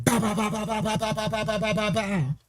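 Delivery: rapid "ba-ba-ba" gunshot noises; imitated gunfire. Imitating recorded gunfire after "Freeze.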